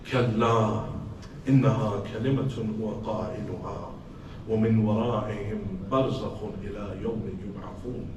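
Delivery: low, quick, soft Arabic recitation; Quranic recitation. Acted. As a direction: recite it swiftly and low as the voice behind. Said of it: "I heard a voice saying".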